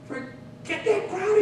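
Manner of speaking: very high, strained scowl. A narrator's reading. Old poet brushing off his critics, scowling. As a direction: dismiss them in a high-pitched, scowling character voice. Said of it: "he scowled".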